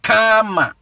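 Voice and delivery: "Karma" as high, stretched kaa-ma; demonstrated pronunciation. Shown as a demonstration. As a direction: hold the first a of "Karma", then drop. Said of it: "best way to pronounce".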